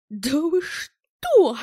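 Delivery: loud, drawn-out indignant hiss. Acted. Ed, half-rising, hisses at the accusing Bell. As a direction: spit it out, outraged. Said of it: "зашипел Эд".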